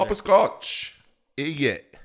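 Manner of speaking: loud, high fake English accent; over-the-top mock British, near laughing; attempted English accent. Shown as a demonstration. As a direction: belt it out in bad English accent. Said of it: "say Papa Scotch in Eng-, English".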